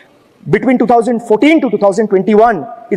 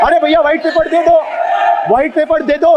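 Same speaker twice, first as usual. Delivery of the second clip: raised call; 4 semitones higher, much faster than usual. Real, not acted. Heckling back in house uproar.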